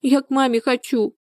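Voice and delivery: put-on high, childlike plaintive voice; acted high-pitched child's whimper; whimpering little girl. A narrator's reading. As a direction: play a small girl whimpering for her mother. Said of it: "всхлипнула Дашутка".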